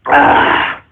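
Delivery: breathy "ah" after a swig; post-drink gasp of satisfaction; satisfied exhale. Real, not acted. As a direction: let out a voiceless refreshed sigh. Takes a drink.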